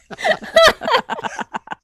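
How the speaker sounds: loud, high-pitched burst of laughter. Real, not acted. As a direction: laugh hard at your own punchline. Laughs at own punchline.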